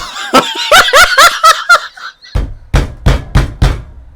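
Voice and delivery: high wheezy laughter; long wheezing laugh. Real, not acted. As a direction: lose it completely.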